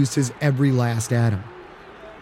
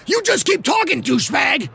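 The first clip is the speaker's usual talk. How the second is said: loud, much higher furious yell; growling shout at the rival quarterback; growled shout; 3 dB louder than usual. Acted. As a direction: bellow the insult across the field. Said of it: "Tweedy growled".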